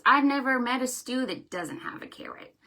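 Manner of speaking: usual speaking voice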